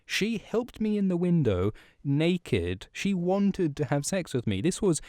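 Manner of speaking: indignant Ron. Retold or imitated. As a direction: protest your innocence, worked up. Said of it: "Ron comes in and he's like".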